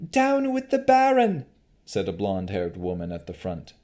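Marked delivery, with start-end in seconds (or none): 0.1-1.5 high, loud call to revolt; rallying cry. Acted. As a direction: start the protest. Woman starts the cry.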